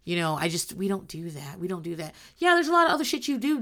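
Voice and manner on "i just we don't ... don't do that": prim mock Kimberly. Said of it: "Kimberly's talking about".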